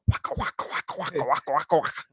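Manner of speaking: rapid, rhythmic chomping waka sounds; Pac-Man munching noise. Shown as a demonstration. Host demonstrating a game sound, rhythmic chomping. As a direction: imitate an arcade game's munching. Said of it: "Pac-Man".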